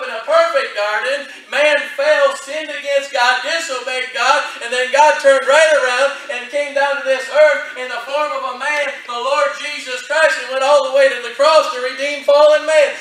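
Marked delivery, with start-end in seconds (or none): 1.5-13.0 rising preacher's cadence, louder; preaching. Acted. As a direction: preach it with building force. Preaching builds to full force.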